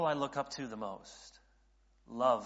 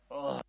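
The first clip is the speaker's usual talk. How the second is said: an acted "ugh" of dread; mock dismayed groan. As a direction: groan at the unwelcome announcement. Congregation groans at sermon series.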